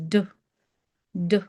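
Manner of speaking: d short, with only a trace of vowel; slight a. Shown as a demonstration. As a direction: tap out a brief d. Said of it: "slight a".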